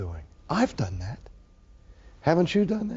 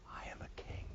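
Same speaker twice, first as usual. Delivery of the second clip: whispered; 20 dB quieter than usual. Acted. Preacher repeats Jesus' claim to Rome as an awed whisper. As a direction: breathe it like a sacred secret.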